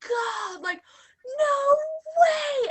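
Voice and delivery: shrieking, high and loud; mock shriek of recognition; loud excited shriek. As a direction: squeal it out in disbelief. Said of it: "he's a very loud individual".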